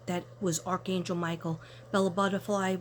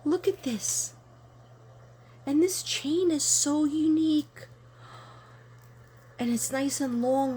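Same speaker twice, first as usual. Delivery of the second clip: excited delight; 7 semitones higher than usual. Real, not acted. Showing viewers the Saint Michael pendant, excited gushing. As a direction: gush over the pendant.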